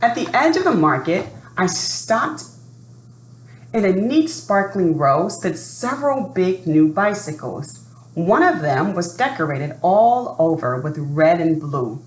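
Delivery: lively read-aloud storytelling voice; story narration. A narrator's reading. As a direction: read it like a children's picture book. Reading a picture book aloud.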